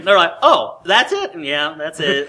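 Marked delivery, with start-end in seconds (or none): 0.4-1.3 high, loud, incredulous question; mock surprise. Guests finally spotting what they asked about, amused mock surprise. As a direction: imitate a guest taken aback by the obvious. Guests' reaction to obvious directions.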